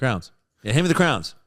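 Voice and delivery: crayons flattened into the one-syllable crowns. Retold or imitated. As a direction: say crowns casually, as a local means crayons. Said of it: "says it more like crowns".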